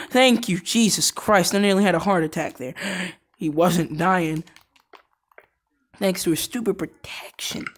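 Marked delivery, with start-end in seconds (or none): none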